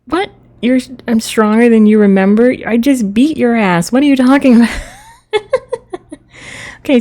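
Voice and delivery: ordinary talk, nothing marked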